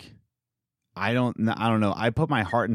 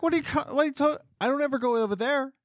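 much higher, fast, sputtering indignation; exasperated outburst; 10 semitones higher, faster than usual. Retold or imitated. Telling the owner off, high and exasperated. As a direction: let the outrage tumble out. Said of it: "I'm just like".

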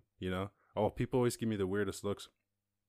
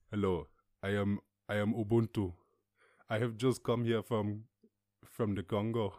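mock African accent. Newcomer from the Congo introducing himself, mock African accent. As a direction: parody what strangers expect in a mock African accent. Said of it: "speak like a fucking, like a real jungle man".

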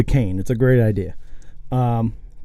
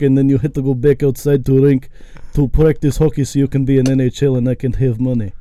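put-on a light Russian mother accent; lightly voiced Russian hockey mom scolding. Dreamed Russian mom lecturing her son, light Russian accent. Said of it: "this big late Russian lady goes".

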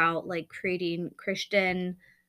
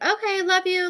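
much higher, louder, tossed-off bedtime line; rushed mock bedtime; 12 semitones higher, 6 dB louder than usual. Mom rushing the bedtime blessing, mock impatient. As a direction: rattle it off like a chore. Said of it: "very, like".

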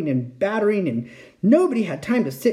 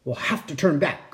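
usual speaking voice

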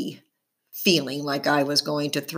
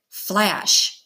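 ordinary delivery

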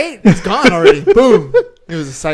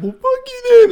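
much higher and louder, a hammed-up snap; mock angry retort; 8 dB louder than usual. Imagined comeback to the rude client, hammed-up angry. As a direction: fling it back like flipping them off.

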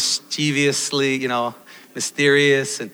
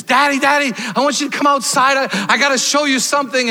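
put-on higher, eager kid voice, quick; a lightly voiced excited son; excited little boy; 9 semitones higher, 3 dB louder, faster than usual. Son calling his dad out to the backyard, lightly voiced and eager. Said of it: "And he says".

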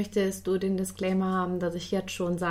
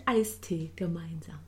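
whispering; 3 semitones lower, 7 dB quieter than usual. Acted. Whispered punchline of riddle.

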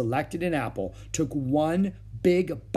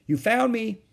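pointing out; 11 semitones higher, 5 dB louder than usual. A narrator's reading. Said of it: "Ramona pointed out".

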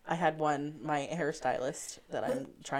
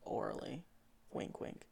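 whisper; 9 dB quieter than usual. Real, not acted. Joking aside.

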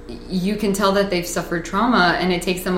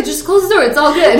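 higher nonchalant dog; casual dog voice; 6 semitones higher, 4 dB louder than usual. Retold or imitated. The dog telling her to close the door, mock chill. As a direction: voice the unbothered dog. Voicing the dog's reply.

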